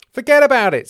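loud, high brush-off; emphatic dismissal. Acted. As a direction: bat the worry away forcefully. James brushes the worry off.

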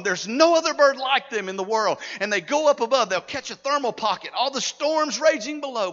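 loud, rousing sermon delivery; full-force preaching. Real, not acted. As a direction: preach it out to the whole congregation.

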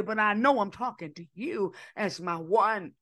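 normal talking voice

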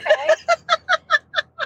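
bright burst of laughter; high laugh. Real, not acted. Laughing at mispronunciation.

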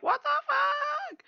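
high squeaky shocked yelp; mock shock. Jon and Davos seeing the dragons, high shocked squeal. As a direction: squeal it like you've never seen a dragon.

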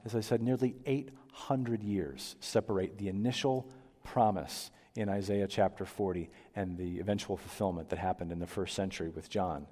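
ordinary talk, nothing marked

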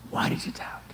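3 breathe the question in a hush; 4 intimate whispered rebuke. Shown as a demonstration.

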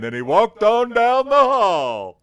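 subdued start swelling into a high, loud rock wail; mock rock-singer impression, exploding at the end. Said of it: "do the impression the whole time".

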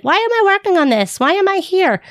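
put-on high, whiny puzzled kid voice; student questioning why they are in speech; imitating a student. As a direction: complain like a bored student.

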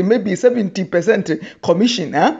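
ordinary talk

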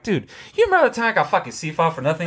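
high, rushed voice of laughing disbelief; mock indignation. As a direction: protest fast and high, half laughing. Indignant retelling to friend, laughing.

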